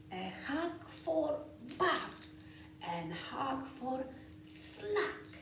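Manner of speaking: lively, sing-song picture-book read-aloud with whispered bits; reading to children. A narrator's reading.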